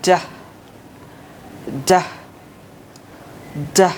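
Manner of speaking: "ডা" with a clear, released d; model d sound. Shown as a demonstration. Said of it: "এটার উচ্চারণটা হচ্ছে". ("ডাহ" স্পষ্ট করে)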